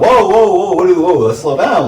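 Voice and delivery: high, loud whoa-whoa to settle someone down; alarmed calming. Retold or imitated. Man to his snapping girlfriend, loud alarmed calming. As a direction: back off fast, hands-up alarm in the voice.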